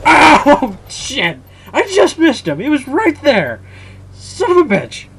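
high, wailing protest at a miss; anguished frustration. Real, not acted. Gamer who just missed the enemy, anguished high yelling. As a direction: cry out in real exasperation. Agonized over a near miss.

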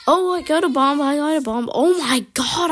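loud, high, rushed exclaiming; excited panic. Real, not acted. Player suddenly holding the bomb, excited panic.

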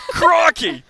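high, loud Aussie "Crikey"; Australian accent. Shown as a demonstration. Caster demonstrating an opener to be left hanging, loud Australian accent. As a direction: exclaim it in hammed-up Australian, then stop. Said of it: "Just go".

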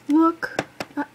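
loud, high call to look; excited shout. Real, not acted. Fan shouting at viewers to look at a photo. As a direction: shout it out, thrilled.

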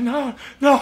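high, loud mock-panicked protest; panicked refusal. Nervous newcomer refuses the dance circle, mock panic. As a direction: back out loudly, on the edge of laughing.

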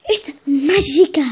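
loud joyful cry; excited shout. Real, not acted. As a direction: cry it out like a magic reveal. Cries out at the trick.

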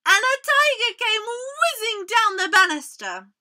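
big, loud storybook excitement; animated children's storytelling. A narrator's reading. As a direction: build up the noisy surprise for young listeners. Reads an exciting story aloud to children.

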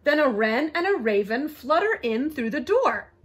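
lively, sing-song storybook reading; bouncy read-aloud with big pitch swings; story narration. A narrator's reading. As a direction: read a rhyming animal tale to children.

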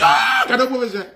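high mock-shocked cry of protest; shocked outburst. Student reacting to a rude answer about professors, shocked. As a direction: imitate a scandalised man squealing in shock. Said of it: "il m'a choqué".

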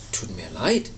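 low-voiced lady giraffe; giraffe character. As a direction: drop low for an apologetic giraffe. Giraffe answers the little bird.

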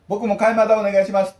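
替えまだ said on purpose as "mada", loud and high; deliberate mispronunciation. Retold or imitated. Teller joining in to order 替えまだ, loud. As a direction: copy the mistake boldly, 替えまだ. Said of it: "沖縄人として".

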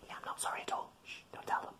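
mock-secret whisper; playful whisper. Secret aside about Mum and Dad.

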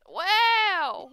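big, high, drawn-out exclamation; loud, playful indignation; mock-outraged shout. Real, not acted. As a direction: cry out as if scandalized. Mock outrage, laughing after.

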